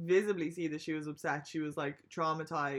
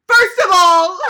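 mock shout, far higher and louder, cracking into laughter; aggressive opening; 13 semitones higher, 23 dB louder, slower than usual. Nominee opening his statement to the panel, hammed-up furious shout. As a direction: bark it out like an indignant nominee. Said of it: "so aggressive".

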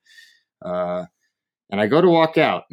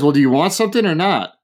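mock impatient demand, pitched up; impatient waiter; 6 semitones higher, 4 dB louder than usual. Waiter pressing a diner who declined, mock impatient. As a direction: press the customer for an answer.

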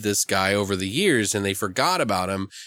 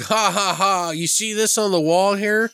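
mocking tavern patrons; 6 semitones higher than usual. Voicing mocking bar patrons.

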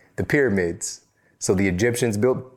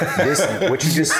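laughing; 7 semitones higher than usual. Real, not acted. Laugh heard.

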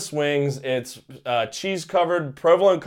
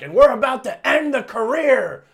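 louder, higher villain announcer; dramatic announcer; 5 semitones higher, 6 dB louder than usual. Retold or imitated. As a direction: boom it out like a heel commentator. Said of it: "Don's like".

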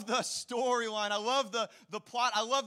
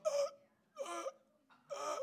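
gasping for air; 12 semitones higher, 3 dB quieter, much slower than usual. Retold or imitated. Imitating being winded.

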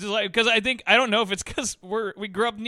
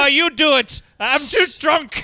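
loud, higher, drawn-out Canadian refusal; mock Canadian accent; 5 semitones higher, 7 dB louder, slower than usual. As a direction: beg off in broad mock Canadian. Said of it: "We're so Canadian".

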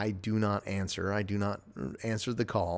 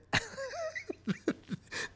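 sudden high-pitched burst of laughter; 5 dB quieter than usual. Real, not acted. Podcaster laughing at his accidental threat, high laugh. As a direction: crack up high at your own joke. Laughs at own ominous remark.

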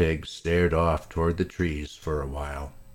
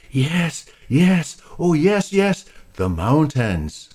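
high, louder gushing yeses; very excited; 10 semitones higher, 6 dB louder, slower than usual. Acted. Molasses hears about the mountains, excited. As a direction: bubble over with joy. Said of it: "got very excited and said".

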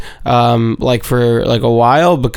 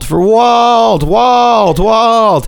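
much higher, louder cries of the name; mock desperate yell; 9 semitones higher, 3 dB louder, much slower than usual. Imitating the father screaming for his missing son, hammed-up.

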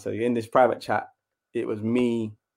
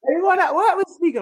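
much higher, sing-song mum asking; mum's voice; 4 dB louder than usual. Retold or imitated. Mum asking her son about his football club, high. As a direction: lift into a chirpy mum voice. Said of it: "She's like".

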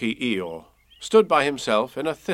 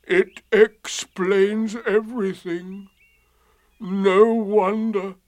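slow, higher, mournful donkey; gloomy; 8 semitones higher, 5 dB louder, slower than usual. A narrator's reading. Eeyore learning his tail is gone, gloomy. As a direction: drag the words out dismally. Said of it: "said Eeyore gloomily".

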